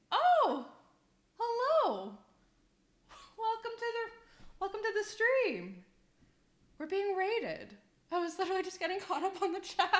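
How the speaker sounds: high-pitched, delighted surprise; excited greeting. Real, not acted. Streamer greets raiders arriving mid-story, excited and high. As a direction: squeal a welcome to sudden guests.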